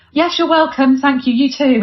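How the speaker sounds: awkward reply. Retold or imitated. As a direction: blurt the polite words a bit too high. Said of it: "said something really weird back".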